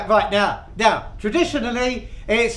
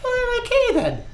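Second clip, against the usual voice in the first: high-pitched mock old-widow reply; widow's voice; 14 semitones higher than usual. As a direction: squeak it as the joke's widow. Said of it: "Missus MacLeod".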